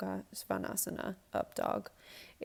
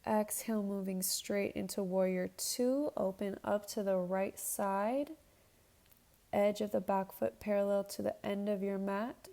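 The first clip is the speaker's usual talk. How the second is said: yoga instructor cueing. Acted. Leads a yoga flow.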